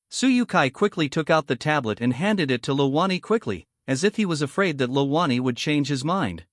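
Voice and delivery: ordinary delivery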